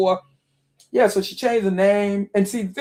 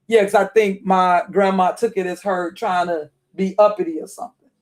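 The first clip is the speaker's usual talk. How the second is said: ordinary delivery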